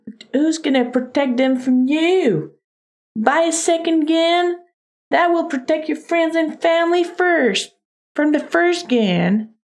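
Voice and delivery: high-pitched hammed-up Texan; bad Texas accent. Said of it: "Doing a really bad Texas accent".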